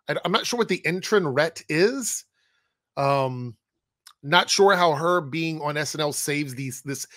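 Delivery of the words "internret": "internret" with "ret" in place of "net"; misspelling read literally. Shown as a demonstration. Puzzling over the title's typo, misspelling read literally. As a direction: repeat the typo "internret", keeping the stray R. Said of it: "I'm assuming they mean internet".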